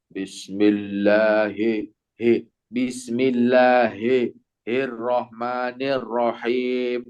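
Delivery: very slow bismillahirrahmanirrahim with hi bent to e; lazy unspread kasroh. Shown as a demonstration. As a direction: recite without grinning so hi leans to e. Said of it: "nggak mau meringis".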